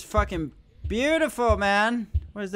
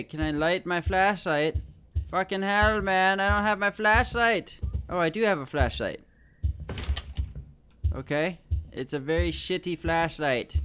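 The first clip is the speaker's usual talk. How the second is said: ordinary delivery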